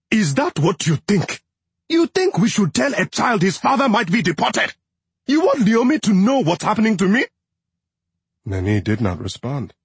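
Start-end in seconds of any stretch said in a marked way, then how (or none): 0.1-7.2 raised, heated husband's voice; angry shout. A narrator's reading. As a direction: push back at her, upset. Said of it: "he shouted".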